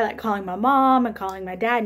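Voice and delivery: ordinary talk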